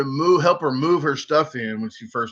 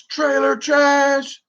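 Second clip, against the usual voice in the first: much higher, slow sneering imitation of her; mocking the neighbour; 12 semitones higher, 3 dB louder, much slower than usual. Neighbour sneering an insult at him, slow high mocking imitation. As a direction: drag out her insult in a shrill mock voice. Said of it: "She goes".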